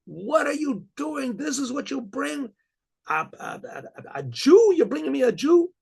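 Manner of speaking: high, outraged yell at the intruder; screaming and yelling. Retold or imitated. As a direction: bellow it high like a furious baron. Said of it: "screams and yells".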